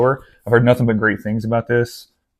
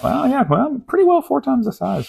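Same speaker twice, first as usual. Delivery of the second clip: pitched way up, squeaky musing; high-pitched reckoning; 14 semitones higher than usual. Real, not acted. Book vlogger comparing an atlas with a novel, high-pitched musing. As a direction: jump into a high voice while sizing it up. Playfully sizing up two books.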